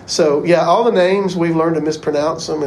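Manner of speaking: usual speaking voice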